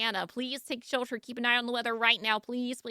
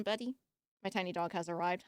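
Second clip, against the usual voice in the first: normal talking voice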